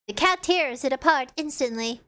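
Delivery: ordinary delivery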